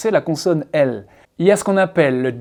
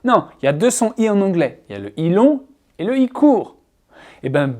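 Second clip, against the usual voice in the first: usual speaking voice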